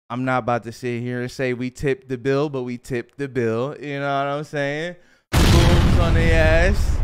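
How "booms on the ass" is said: loud, very drawn-out whoop of words; hyped exclamation. Real, not acted. Hyping up the story of tipping the bill, loud exclamation. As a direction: blast it out, stretching every word. Celebrating the tip.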